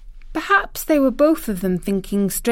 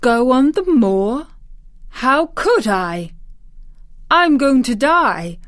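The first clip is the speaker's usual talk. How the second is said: put-on slow, louder and higher startled boy; 5 semitones higher, 5 dB louder, slower than usual. A narrator's reading. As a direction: spell it out slowly, shaken by the idea. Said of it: "as if he were startled".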